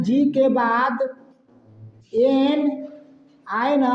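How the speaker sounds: each piece drawn out and set apart; slow repetition. Shown as a demonstration. Teacher repeats the rule for pupils, slow dictation. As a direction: repeat it slowly, pausing between chunks. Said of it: "फिर भी एक बार repeat कर दे रहा हूँ".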